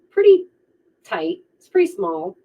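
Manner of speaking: pretty squeaked high, then whispered; stressed pretty. Acted. Emphasising small dating pool.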